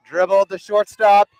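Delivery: loud, rising, slowed-down final call; excited game-winning call. Acted. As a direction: shout the last out with rising excitement. Game-ending out called.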